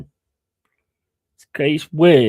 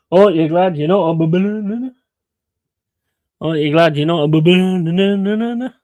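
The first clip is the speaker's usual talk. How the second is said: higher, louder mock delivery of the punchline, lightly voiced; imitating the lad's punchline; 5 semitones higher, 4 dB louder than usual. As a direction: repeat it over and over like the character. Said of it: "he just keep going".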